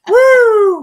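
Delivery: loud, high "woo" amid laughter; laughing whoop. Real, not acted. Cracking up over the duties joke, loud whoop.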